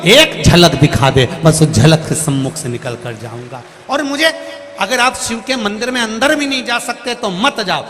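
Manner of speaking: high, loud preaching climax; fervent preaching. Acted. Preacher urging devotees to call on God, fervent and loud. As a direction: preach it out with full conviction.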